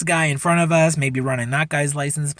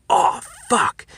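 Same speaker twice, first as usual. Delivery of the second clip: alarmed; 5 semitones higher than usual. Retold or imitated. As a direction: act out a buddy's sudden dread. Buddy panics at spotting police.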